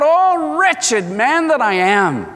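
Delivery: anguished cry, the Oh soaring high, the rest slow; a slow, high lament of a wretched sinner. Acted. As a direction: cry out in slow anguish over your sin. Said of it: "you will cry out".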